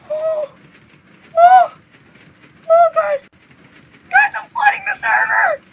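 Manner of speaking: very high, loud squeals; excited squealing. Real, not acted. Gamer flooding the server tells viewers, excited squealing. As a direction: squeal with thrill at your success.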